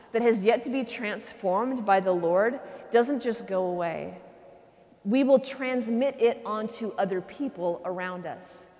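ordinary delivery

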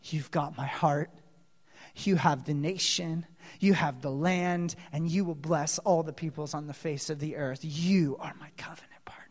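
low, soft intimate voice; God tenderly promising Abraham a covenant; tender hushed promise. Acted. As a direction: speak the promise softly. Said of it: "And God says".

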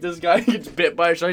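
normal talking voice

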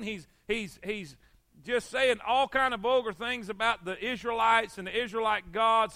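normal talking voice

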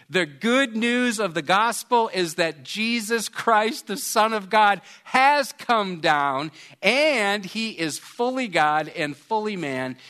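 full-force preaching. Acted. Preacher announcing the gospel to the congregation, full force. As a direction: proclaim the good news with fire.

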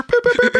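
very high, squeaky pew-pew sounds; rapid falsetto mock sound effect; imitated pew noises. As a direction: fire off playful high-pitched pews. Imitating noises in pizza bit.

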